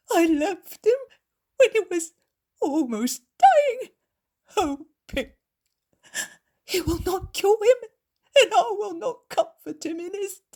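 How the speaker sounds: high, loud sobbing lament; a mother sobbing in despair, voice breaking high; weeping. A narrator's reading. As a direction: wail it out between sobs, slowing down. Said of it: "The woman begins to weep".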